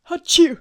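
loud sudden sneeze; big explosive sneeze; sneezing. Real, not acted. Outtakes full of sneezes.

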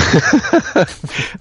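hohes, kurzes Auflachen; hohes Lachen. Real, not acted. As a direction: hell auflachen. Lachen mitten in der Antwort. (lacht hoch auf)

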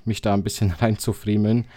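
ordinary delivery

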